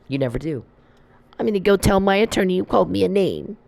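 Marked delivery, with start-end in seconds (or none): 1.4-3.6 put-on high, sneering baby voice; mock him as a crybaby; mocking whiny voice. Mimicking the man who tattles to his attorney, whiny.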